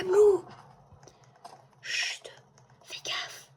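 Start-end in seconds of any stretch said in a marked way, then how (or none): 1.8-3.5 hushed whisper, hiding from a monster; whispering while hiding. Real, not acted. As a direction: whisper so nobody hears you. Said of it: "je sais pas pourquoi je parle doucement".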